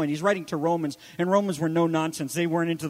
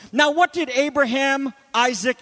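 ordinary delivery

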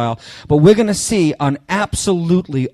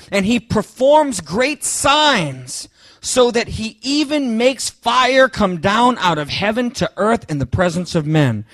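much higher, slow proclaiming read; scripture reading; 5 semitones higher, slower than usual. Acted. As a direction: draw out the verse with awe. Preacher reads scripture aloud.

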